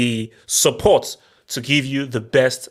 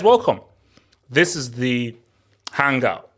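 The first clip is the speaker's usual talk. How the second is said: normal talking voice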